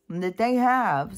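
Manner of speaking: ordinary delivery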